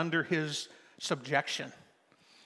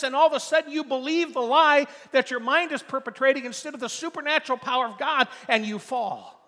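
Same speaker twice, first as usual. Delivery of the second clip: higher, louder, building sermon climax; preaching at full force; 7 semitones higher, 5 dB louder than usual. Real, not acted.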